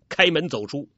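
usual speaking voice

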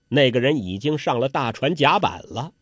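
ordinary delivery

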